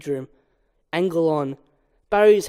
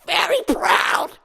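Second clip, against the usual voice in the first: high, slow gushing mom voice; doting mother; 11 semitones higher, slower than usual. Acted. Mother fusses over Barry's hair, high and slow. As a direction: coo it much higher.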